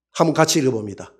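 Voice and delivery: ordinary talk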